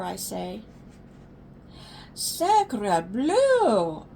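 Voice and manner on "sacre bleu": French exclamation. Acted. Child detective exclaims in French.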